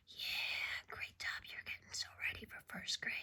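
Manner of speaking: soft classroom whisper. Real, not acted. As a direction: whisper so they can count. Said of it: "touch and whisper count".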